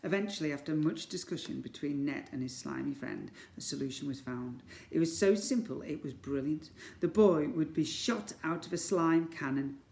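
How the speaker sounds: ordinary delivery, nothing marked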